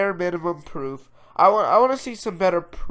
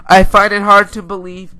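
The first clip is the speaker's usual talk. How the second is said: louder, higher, emphatic repeat; raised voice, insisting; loud insistence; 4 semitones higher, 13 dB louder than usual. Real, not acted. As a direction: say it forcefully to drive it home. Repeats his point with emphasis.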